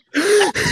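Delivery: high laugh. Real, not acted.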